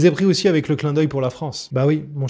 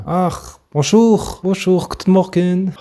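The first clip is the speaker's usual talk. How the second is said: French greeting in a thick mock German accent, higher; terrible German accent; 4 semitones higher, slower than usual. Host mimics his German hosts' timid greeting in German accent. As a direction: ham up a heavy German accent on bonjour. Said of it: "l'accent terrible".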